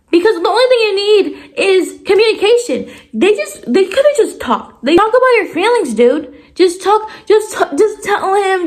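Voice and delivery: high-pitched, pleading exasperation; exasperated. Real, not acted. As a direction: plead with clueless book characters, rising to a squeal. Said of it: "it really irritates me".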